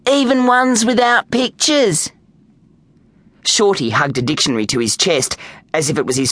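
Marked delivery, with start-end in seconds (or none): 0.1-2.1 high, slow, sugary innocence; adorable act. A narrator's reading. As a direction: draw it out sweetly with a smile. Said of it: "She smiled adorably".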